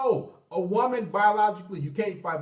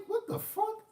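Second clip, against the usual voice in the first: incredulous; 13 semitones higher, 8 dB quieter, slower than usual. Retold or imitated. Mock disbelief at crying woman.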